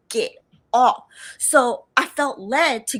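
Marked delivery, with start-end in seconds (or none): 0.1-1.0 sharp, loud repeated order; commanding voice. Retold or imitated. The voice repeating its order on the train, loud command. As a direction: say it again, firm and loud. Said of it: "I heard it again".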